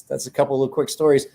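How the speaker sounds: normal talking voice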